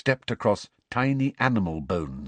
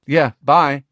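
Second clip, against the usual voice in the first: louder, higher quick goodbye; loud bye; 6 semitones higher, 10 dB louder than usual. A narrator's reading. Harry replies.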